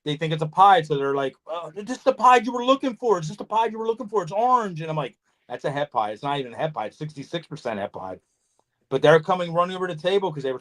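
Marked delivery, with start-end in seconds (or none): 1.5-4.8 higher-pitched mock excitement of a newcomer; eager buyer imitation. Imitating eager, clueless buyers at table. 5.5-8.2 low, subdued letdown reply; retold reply, deflating their excitement in a lower voice; deadpan correction. His deflating reply to excited buyers.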